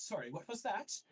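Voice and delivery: lightly voiced official catching a whisper; questioning inquisitor. High Inquisitor catches the captain whispering, pointed questioning. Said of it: "the High Inquisitor goes".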